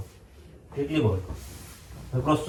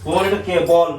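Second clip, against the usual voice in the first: raised, loud and high for deaf ears; to someone hard of hearing; 4 semitones higher, 3 dB louder, slower than usual. Acted. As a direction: call it out to granny who can't hear. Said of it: "ঠাকুমা কি কানে শুনতে পায় নাকি".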